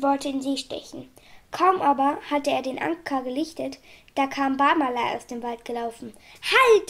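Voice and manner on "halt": high, loud shout; shouted command. A narrator's reading. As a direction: yell to stop someone getting away. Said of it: "schrie er". (lauter, hoher Schrei)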